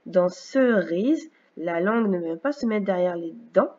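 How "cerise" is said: very slow "cerise", both syllables stretched. Shown as a demonstration. Teacher testing a word for the sound, slowly syllable by syllable. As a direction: pull "cerise" apart into "ce" and "rise".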